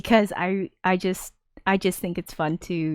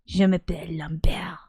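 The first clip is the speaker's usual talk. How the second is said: hushed, airy attempt at French; put-on French accent; bad French. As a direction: introduce yourself in clumsy mock French. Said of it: "My French is shit".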